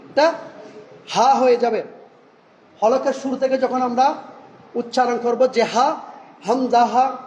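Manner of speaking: usual speaking voice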